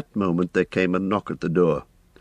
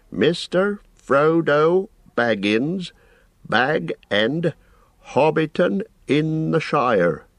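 slow, higher, proud reading of an address; slowly and proudly; 10 semitones higher, 3 dB louder, much slower than usual. A narrator's reading. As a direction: savour every word like a man proud of his letters. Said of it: "reading out the address slowly and proudly".

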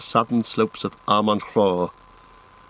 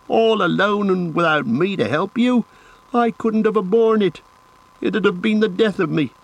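high, impassioned outburst; indignant, high-pitched pleading; distressed protest; 9 semitones higher than usual. A narrator's reading. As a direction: protest as if deeply hurt. Sam protests being left behind.

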